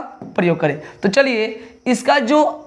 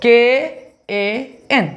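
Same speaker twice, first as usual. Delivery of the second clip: केएएन spelt out letter by letter, very slowly; teacher dictating केएएन very slowly; very slow spelling; much slower than usual. Shown as a demonstration. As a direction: stretch each letter of केएएन. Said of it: "तो ध्यान से सुनिएगा".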